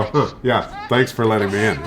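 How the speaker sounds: low, soft, grudging dog voice; sulky and offhand, dropped low and quiet; Marty. Retold or imitated. Dog walking right by owner, grudging low thanks. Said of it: "like, walk right by".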